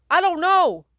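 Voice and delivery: high, loud panicked shout. Acted. Randy shouting back to the girl, high and loud. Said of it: "Randy shouts back".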